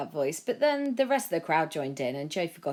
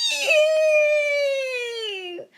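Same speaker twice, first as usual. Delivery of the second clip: put-on much higher, louder toddler shriek; screaming toddler; 9 dB louder than usual. A narrator's reading. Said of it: "one toddler screamed".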